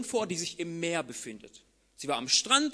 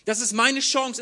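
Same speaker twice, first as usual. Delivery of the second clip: ordinary talk, nothing marked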